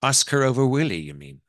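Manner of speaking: Irish matriarch checking what is meant; Irish accent. Acted. Mother-in-law questions her son's wife, Irish accent. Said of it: "that's my Irish accent".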